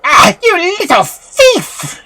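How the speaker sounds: high, slow cackling witch cry; witch exclaiming. A narrator's reading. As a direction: pounce on the child like an old witch. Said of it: "she exclaimed".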